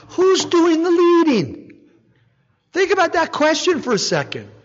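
normal talking voice

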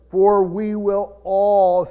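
emphatic reading, holding "all" long and strong; stress on all. Real, not acted. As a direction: read it slowly and land hard on "all". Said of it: "see the word all there".

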